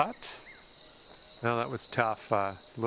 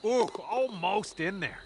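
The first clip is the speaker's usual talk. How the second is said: excited near miss; 7 semitones higher than usual. Real, not acted.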